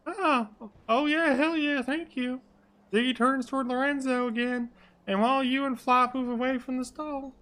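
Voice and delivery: high falsetto sidekick; high-pitched happy thanks; Flop delighted. Acted. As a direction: keep up the high put-on character voice. Flop's voice carried into narration.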